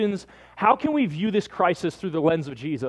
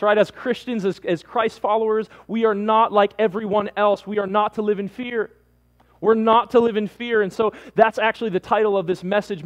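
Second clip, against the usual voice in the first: preaching at full force; 3 semitones higher than usual. Acted. Pastor rallying his church against fear, preaching at full force. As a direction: preach it with full conviction to the congregation.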